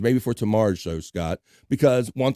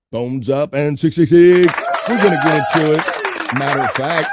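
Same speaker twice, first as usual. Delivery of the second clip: hype call; 3 semitones higher, 6 dB louder than usual. Real, not acted. Hyping upcoming segment.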